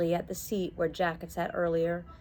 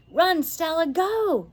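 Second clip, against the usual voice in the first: high, louder urgent cry; a girl urging her friend to run; urgent shout; 10 semitones higher, 5 dB louder, slower than usual. Acted. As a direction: send her running.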